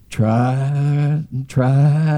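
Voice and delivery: very slow, stretched words; drawn-out effort. Retold or imitated. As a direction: drag each word out like a long struggle. Mimes doctor's long effort with needle.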